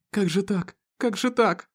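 high, distressed wail of disbelief; lamenting. A narrator's reading. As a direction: lament over an injured husband. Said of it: "всё причитала она". (причитая, высоким голосом)

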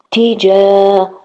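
تجا stretched, high and slow, the long a held; blending syllables. Shown as a demonstration. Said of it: "blending syllables slowly".

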